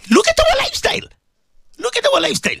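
very high-pitched exclamation; reproachful outcry. Real, not acted. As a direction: cry it out in a piercingly high voice. Preacher cries out in reproach.

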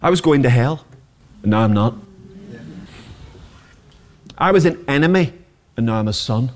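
ordinary talk

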